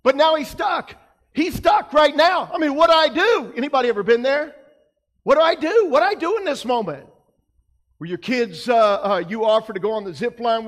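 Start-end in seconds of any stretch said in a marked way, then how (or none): 0.0-7.1 higher, louder worried cry; mock panic. Preacher acting Peter stuck in the boat, higher and louder.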